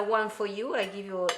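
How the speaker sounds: usual speaking voice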